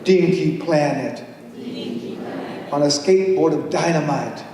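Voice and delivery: big swoops up in pitch, stretched slow words, laughing; playful poem performance. Acted. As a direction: perform it like a giddy kids' rhyme.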